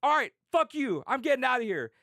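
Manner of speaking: hammed-up giving up on a friend; fed-up anger. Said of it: "He's like".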